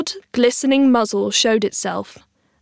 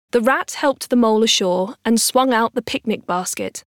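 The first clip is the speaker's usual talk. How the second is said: plain reading voice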